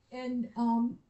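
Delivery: usual speaking voice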